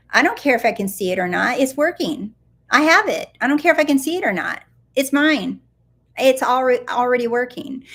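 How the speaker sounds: defiant certainty. Shown as a demonstration. Coach showing a viewer how to claim it, defiantly certain. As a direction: declare it stubbornly, rising in pitch and force. Voicing defiant declarations that it works.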